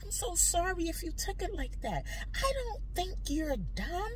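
high, soft, soothing flattery; placating apology. Retold or imitated. Wife reassuring partner who felt called dumb, soothingly. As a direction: coo it gently to pacify.

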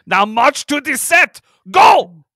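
put-on loud, slow shouted order in a German accent; mock German command. Director ordering his star to set, shouted mock German accent. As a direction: bellow it, exploding on the last word.

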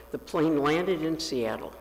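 ordinary delivery, nothing marked

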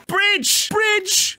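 high, loud yelp of the word Bridge!; excited shout. Real, not acted. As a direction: call it out in a sudden burst.

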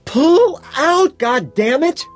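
very high, loud scream. A narrator's reading. Woman ordering her ignoring lover off, screaming. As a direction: scream the order at full pitch. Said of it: "she screams".

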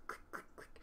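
soft voiceless clicking; ticket-punch clicks. Acted. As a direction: imitate a ticket punch, crisp and quiet. Imitates conductor punching tickets.

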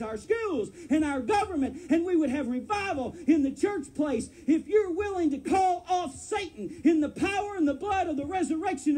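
high, loud, ringing preacher's shout; fiery preacher rousing the church to call off Satan; preaching at full force. Acted. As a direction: whip the congregation up to stand.

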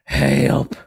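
hammed-up trapped plea, loud then sinking low; mock cry for help. Host pretending to be stuck in an accent, mock distress. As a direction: call out, then drag it into a slow, low strain. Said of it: "I'm stuck in an accent".

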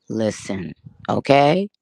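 usual speaking voice

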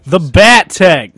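slow, loud, over-enunciated announcement. Acted. Mocking game characters discussing a secret, very loud. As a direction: boom it out slowly, word by word. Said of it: "They're saying that very loud".

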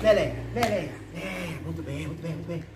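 cheerful praise for a dog; talking to a dog. Real, not acted. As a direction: egg the dog on, then soothe. Playing tug with the dog.

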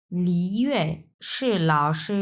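slow careful Mandarin syllables for লি ইউয়ে সে লাও সে; Chinese reading. Shown as a demonstration. Teacher models Chinese sentence for students, careful Mandarin reading. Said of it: "এখন কিভাবে এটা আমরা পড়ব?".

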